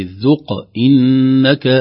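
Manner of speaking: slow, loud ذق with a bouncing q; qalqalah. Shown as a demonstration. Teacher demonstrating qalqalah, bounced q in ذق. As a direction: echo the q in ذق, then hold إنك. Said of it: "نظهر القلقلة".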